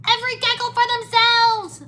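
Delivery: very high, loud panicked squeal; shouting gecko. A narrator's reading. Gecko abandons the group, panicked high shout. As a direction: squeal it out like a small fleeing creature. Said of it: "a gecko shouted".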